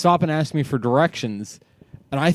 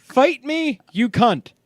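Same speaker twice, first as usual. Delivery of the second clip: put-on higher, taunting kid's challenge; mock cocky kid; 10 semitones higher, slower than usual. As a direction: repeat it like a cheeky boy daring his mom. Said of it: "My man repeats himself".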